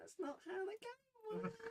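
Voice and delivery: put-on high, eerie little-girl voice; creepy child voice.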